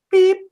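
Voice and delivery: high beep; microwave beep imitation. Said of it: "it'll do the, like".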